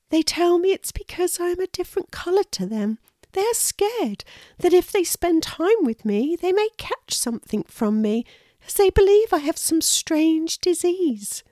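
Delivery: high, quick-starting small-creature voice; earnest little squirrel. Acted. Lulu explains to the hedgehog why others fear her, high and earnest.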